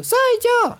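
very high, squeaky cartoon voice; little plane impression. Teacher recalling old cartoon plane, very high impression. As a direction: pipe up in a tiny high voice. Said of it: "el avioncito".

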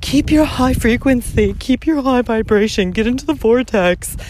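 mock a new-age guru; mocking spiritual advice. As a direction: sneer at spiritual slogans in a preachy voice. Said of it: "spiritual bullshit saying".

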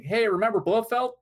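high, loud mock-eager callout; mock excited. Host mocking the film's winking callbacks, mock excited. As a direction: nudge them with hammed-up excitement.

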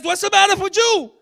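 high, loud indignant shout; strong confrontation. Acted. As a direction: confront them at full voice. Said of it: "This was that confrontation".

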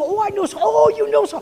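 high, loud mock-delighted exclamation; excited recognition. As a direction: act out thrilled recognition of a shared acquaintance. Acting out finding a mutual acquaintance.

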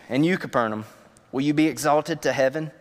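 ordinary talk, nothing marked

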